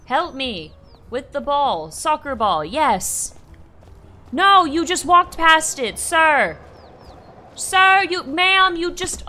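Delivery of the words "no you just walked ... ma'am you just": loud, raised protest; indignant calling out. Pretend. Player to passersby who ignore the ball, loud indignant protest. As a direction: call after them, affronted. Calls out to passing game characters.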